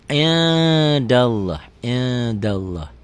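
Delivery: "indallah" with a long ng hum before the d; held ikhfa ng. Shown as a demonstration. Teacher shows how it is read, "indallah" with a held ng. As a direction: slowly stretch "in" into "ing" before "dallah". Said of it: "N-nya ada G-nya".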